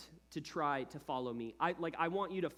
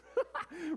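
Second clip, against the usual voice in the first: short laugh; 13 semitones higher than usual. Real, not acted. Laughs at own impression.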